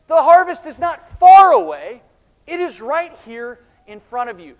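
high, very loud correction; forceful proclamation. Real, not acted. Preacher corrects the disciples' attitude, forceful proclamation. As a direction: hammer "not far away" with full force.

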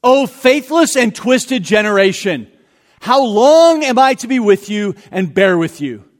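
loud, rising rebuke of a faithless crowd; stern rebuke. Acted. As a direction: call out the rebuke with force. Preacher reads Jesus' rebuke aloud.

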